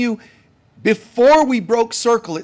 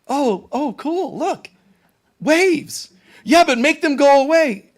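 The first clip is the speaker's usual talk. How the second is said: higher, breezy, unbothered; chill; 7 semitones higher, 3 dB louder than usual. Pretend. Laid-back Jesus admiring the waves, breezy. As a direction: shrug off the storm like nothing. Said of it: "how chill this person is".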